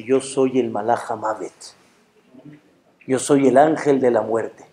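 low, measured otherworldly announcement; Angel of Death. Acted. Answering the man who asks who he is, solemn. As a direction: state your title solemnly, pitched well down. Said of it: "sale una voz".

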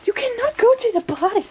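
high, loud, incredulous scolding; scandalized protest. Real, not acted. Wife to naked husband heading for the potty, loud protest. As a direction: cry out in scandalized disbelief.

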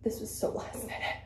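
hushed, breathy confession; whispering. Real, not acted. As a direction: drop to a whisper, stressed. Confiding her stress, voice drops.

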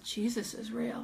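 slow, soft acted astonishment; hushed amazement. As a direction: say it softly, as if stunned. Stunned group looks around.

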